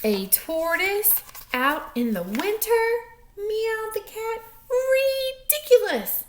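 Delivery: cat mocking the tortoise. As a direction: sneer like a haughty cat.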